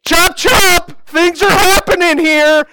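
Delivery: high, loud hurry-up from the delivery bed; impatient wife. Retold or imitated. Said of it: "Christy's just like".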